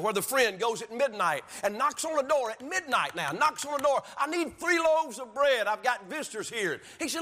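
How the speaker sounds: high-pitched, driving sermon delivery; fervent preaching. Acted. Preacher urging the church to keep praying, fervently. Preaches parable at raised intensity.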